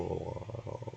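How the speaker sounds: usual speaking voice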